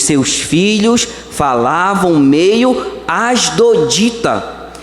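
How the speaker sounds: ordinary talk, nothing marked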